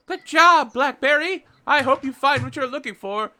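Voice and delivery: very high, loud excited cheer; high loud rabbit crowd cheer. Acted. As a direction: cheer her off on the mission.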